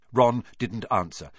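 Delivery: ordinary delivery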